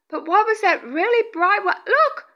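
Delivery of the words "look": high, urgent call to look; excited. Real, not acted. Medium showing viewers an orb, excited. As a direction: get them to look right now. Spots another bright orb.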